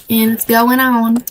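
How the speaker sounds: usual speaking voice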